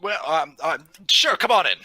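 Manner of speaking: high, loud, faltering invitation; hesitant in-character welcome. Acted. Player letting the stranger join the party, high hesitant welcome.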